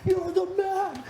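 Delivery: high, slow, stretched praise from a fan; mock fan voice, drawn out high; cheering fan. Crowd cheering him on.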